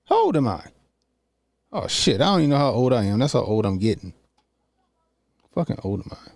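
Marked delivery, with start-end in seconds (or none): none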